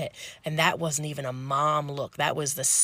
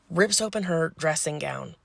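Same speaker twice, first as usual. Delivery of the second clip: ordinary talk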